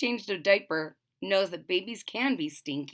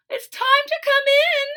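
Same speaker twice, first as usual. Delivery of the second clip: much higher, louder sing-song call; mom calling; 6 dB louder than usual. Acted. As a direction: call the child home. Mom calls Froggy home.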